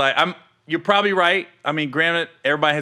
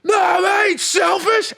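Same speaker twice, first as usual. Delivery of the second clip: high, stretched mock yell; exaggerated high-pitched outburst; imitating an angry yell; 14 semitones higher, slower than usual. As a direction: yell it high and drawn out, mimicking him. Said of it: "you yelling at me".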